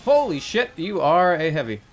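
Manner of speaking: high, loud shocked exclaim; surprised. Real, not acted. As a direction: blurt it out shocked. Surprise at heavy.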